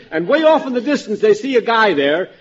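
ordinary talk, nothing marked

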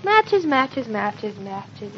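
repeated word fading away to very soft; absentminded self-talk, fading; searching mumble. Acted. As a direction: murmur it to yourself while hunting around, trailing down. Searching for matches, talking to herself.

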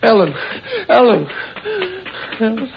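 high, shocked cries; panicked calling. Acted. Man finding his girlfriend fallen from the car, panicked. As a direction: call out for her in horror.

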